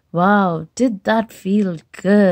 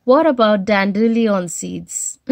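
plain reading voice

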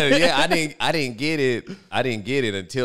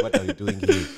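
laughing punchline; 14 semitones higher than usual. Retold or imitated. Punchline of mock proverb.

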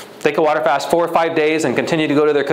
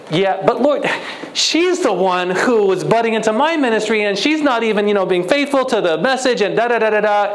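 higher, indignant complaining; whiny excuse; 6 semitones higher than usual. Retold or imitated. Preacher retells arguing with God about the church member. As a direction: protest to the Lord like a sulking child. Whining self-justification to God.

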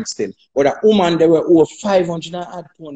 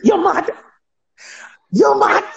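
mock disbelief, much higher; 13 semitones higher, slower than usual. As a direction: scoff at the promoter's offer. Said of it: "I said".

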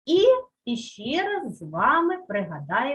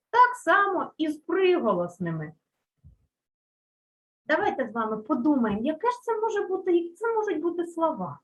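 normal talking voice

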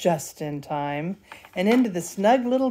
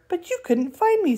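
much higher squeaky cub voice; gleeful high squeak; squeaky little bear; 11 semitones higher than usual. Acted. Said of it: "squeaks Little Bear".

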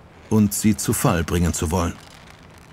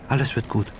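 reassuring; 4 semitones higher, 4 dB quieter, faster than usual. A narrator's reading. Sachiko tröstet Betty.

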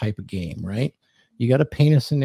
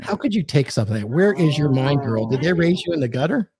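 higher-pitched, teasing scolding; mock indignation; 5 semitones higher than usual. Man teasing his girl over innuendo, mock indignant. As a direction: act scandalized, playfully.

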